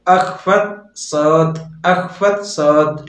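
अखफत सौत drawn out, with a throaty kh; teacher spelling out Arabic for beginners; slow Arabic model. Shown as a demonstration. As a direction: stretch अखफत सौत syllable by syllable. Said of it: "अगर आपको बोलना है तो बोलोगे".

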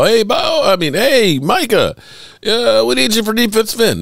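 high-pitched ad-skit coach hollering; imagined coach calling. Pretend. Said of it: "I can see in the ad".

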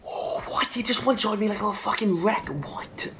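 stunned, breathless what; shocked disbelief. Real, not acted. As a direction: caught off guard, disbelieving. Shocked at being one-shotted.

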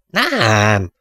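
mild drawn-out yell. Acted. Barker snaps no at colleague's wrong guess, mildly yelled. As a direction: let out a half-hearted shout of refusal. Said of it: "Azért ne üvölts".

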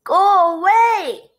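loud, high, drawn-out yell of rejection; angry crowd's shout. A narrator's reading. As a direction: yell it out slowly to drive someone off. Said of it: "yelled the people".

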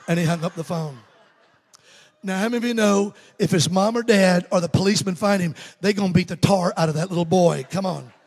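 normal talking voice

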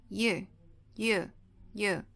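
"U" said as "yoo", with a Y glide; initial Y sound. Shown as a demonstration. Teacher isolates start of unicorn, Y sound in "U". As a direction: repeat "U" separately, each starting on a Y sound. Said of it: "Tem o som de Y".

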